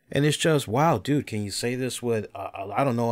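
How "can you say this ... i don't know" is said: usual speaking voice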